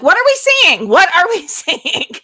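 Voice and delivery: loud, high mock panic breaking into a laugh; alarmed. Mother retelling her panic at the doctor, loud and laughing. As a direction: yell it in retold alarm, laughing through the repeat.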